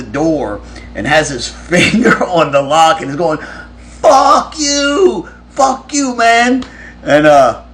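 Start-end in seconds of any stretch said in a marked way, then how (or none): 4.0-6.6 very high, mocking yell; taunting yell. Ben taunting Kirk from behind the locked door, high yell. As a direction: taunt him in a high imitation holler.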